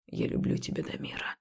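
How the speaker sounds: voiceless, very soft whisper. Acted. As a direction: breathe it in a whisper. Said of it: "прошептал он".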